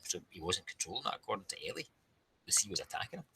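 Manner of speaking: plain reading voice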